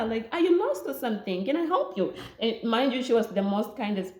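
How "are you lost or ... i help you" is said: lady recognizing her, high and friendly; sweet lady voice. Retold or imitated. Kind lady offering help.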